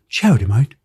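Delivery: a hammed-up Aussie charity, mate; Australian accent. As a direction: repeat it in a lightly put-on Australian accent. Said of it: "I've gone Australian".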